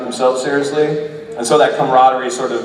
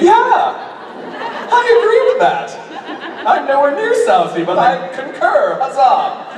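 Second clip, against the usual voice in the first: playful high put-on voice, laughing through it; pompous mock agreement, high and amused; 12 semitones higher than usual. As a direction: play along in a silly higher voice, giggling. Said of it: "I was just playing along".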